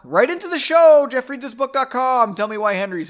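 high, loud announcer plug; calling out. Real, not acted. Host urging listeners to write in, high and loud. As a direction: shout the address out like a radio promo.

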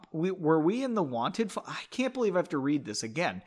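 usual speaking voice

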